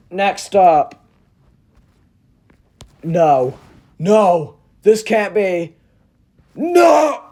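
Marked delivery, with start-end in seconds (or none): 3.0-7.2 hammed-up disbelief rising to a yelp; horrified denial. Reviewer seeing the next prop, dramatic horrified denial. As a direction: refuse to believe it, higher and louder each time.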